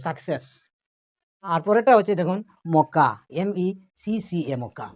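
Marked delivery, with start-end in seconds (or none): 0.1-0.5 success run together as one word; success in one go, not spelled out; said together at speed. Shown as a demonstration. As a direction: join both syllables of success without a break. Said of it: "একসাথে বলতে পারি আমরা স্পিডে".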